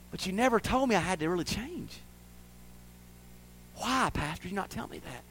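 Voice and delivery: hushed, wounded question; imagined reproach. Retold or imitated. Young ladies asking pastor why, imagined reproach. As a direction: let it sink to a soft accusation. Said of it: "haunt me after they're gone".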